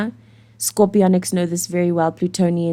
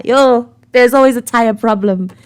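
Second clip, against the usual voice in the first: exasperated; 5 semitones higher, 7 dB louder than usual. Acted. Exasperated rant about car troubles.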